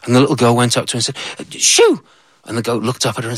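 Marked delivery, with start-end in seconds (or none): 1.5-2.0 sharp high shout of shoo. Acted. Little girl shooing the goat away.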